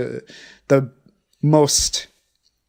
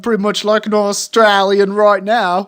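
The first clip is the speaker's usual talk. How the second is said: higher-pitched put-on Aussie accent; Australian accent; 5 semitones higher than usual. Shown as a demonstration. Host asks whether he passes as Aussie, put-on Australian accent. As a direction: show off your best Australian accent. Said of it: "does this sound like an Aussie?".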